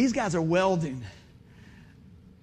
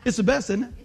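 normal talking voice

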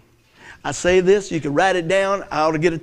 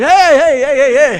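louder, higher playful call, laughing; Bible calling out; 6 semitones higher, 9 dB louder, slower than usual. Pretend. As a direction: call after them like a talking book, hey hey. Bible calling after you.

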